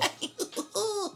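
high sinister Smurf-like cackle; evil cartoon laugh. Real, not acted. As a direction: cackle like an eighties cartoon villain. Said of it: "evil, sinister Smurf sounding laugh".

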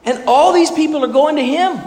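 high, needling mock voice of the disciples; hammed-up high taunt; goading disciples. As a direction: poke at him like a jealous follower. Disciples goading John, retold.

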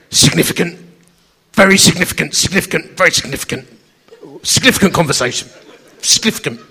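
loud, insistent mate pressing the point; loud insistent repeat. Retold or imitated. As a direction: keep hammering significant loudly. Gasky insistently repeating himself.

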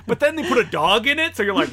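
ordinary delivery

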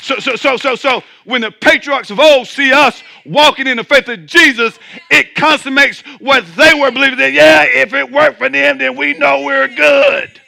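loud, rising preacher's fervor; preaching. Real, not acted. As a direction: preach it out with full force. Preaching at full force.

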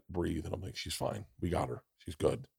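very soft, exhaling relief; relieved breath. Retold or imitated. Father softly telling himself his daughter is fine. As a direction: breathe out and murmur to yourself. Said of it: "I finally go".

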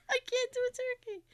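very high, squeaky laughing voice; helpless laughter. Real, not acted. Host gives up on the turkey, helpless laughter. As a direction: squeeze it out high through laughter.

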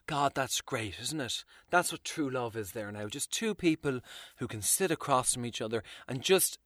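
soft, dreamy mock sentimental coo. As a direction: coo it like someone touched. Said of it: "You're supposed to go".

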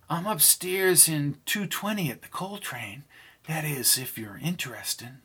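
quiet, teasing invitation; soft inviting voice. Acted. Pam's invitation.

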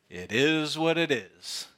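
a lightly voiced shrug of it is what it is; shrugging resignation. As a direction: toss it off with a shrug. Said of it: "the way we say it".

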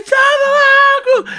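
very high, loud mock shriek; hammed-up impatient yelling; retold scream. Caller screaming at slow brother to hurry, high frantic yell. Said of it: "Eu gritava".

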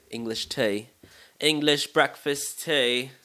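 exaggerated, drawn-out British accent; bad British accent. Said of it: "that was really bad accent".

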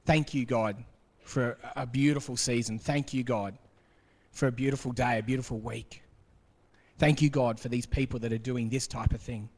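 gently thankful, like a quiet prayer; grateful thanks. Pretend. Imagined grateful headlines.

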